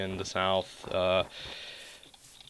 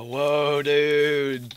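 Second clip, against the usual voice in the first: put-on lightly drawn-out California surfer accent, a touch higher; 4 semitones higher, 3 dB louder than usual. Mocking how he doesn't sound: a light California surfer accent. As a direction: toss off a mock California surfer dude accent. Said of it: "stereotypical surfer California accent".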